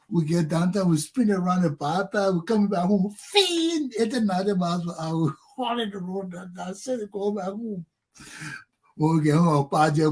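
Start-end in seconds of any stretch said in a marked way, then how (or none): 3.2-3.9 high-pitched whoosh of speed; imitated car zoom. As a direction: whine like a car racing past.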